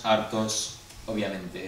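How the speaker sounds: ordinary delivery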